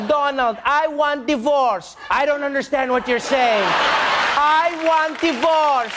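put-on high, slow Eastern European accent; mock Melania with Slovenian accent. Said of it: "She's like".

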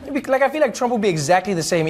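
normal talking voice

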